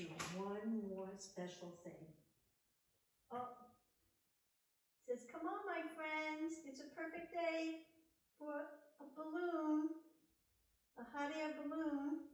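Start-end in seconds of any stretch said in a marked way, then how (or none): 5.3-12.3 cheerful teddy bear. Acted. Teddy bear greeting his spring friends, high and bright. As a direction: greet every friend with delight.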